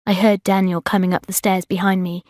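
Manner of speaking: ordinary delivery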